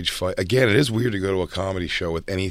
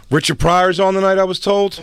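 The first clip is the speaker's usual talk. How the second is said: higher, louder hustler pitch; loud hyped-up ticket pusher; street tout voice; 11 semitones higher, 5 dB louder than usual. Retold or imitated. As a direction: sell the lineup like a shady tout. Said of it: "They'd just be like".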